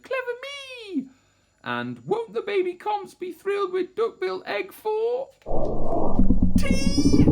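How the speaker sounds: high, squeaky-small dinosaur voice; self-pleased little Comp. A narrator's reading. Comp gloating over her stolen egg, high character voice. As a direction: congratulate yourself, pitched very high. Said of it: "and murmured".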